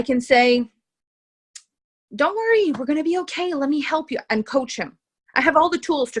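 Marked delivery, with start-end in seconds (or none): 2.2-4.2 high, anxious coddling reassurance; worried caretaking. Acted. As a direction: fuss over him like a worried mother. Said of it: "and you worry for him".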